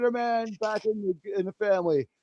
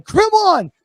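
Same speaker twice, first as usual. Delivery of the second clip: loud, high cry of the donor's name; excited shout; 10 semitones higher, 8 dB louder than usual. Real, not acted. Streamer cheering the donor who gifted memberships, high and loud. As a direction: hail the donor with a burst of joy.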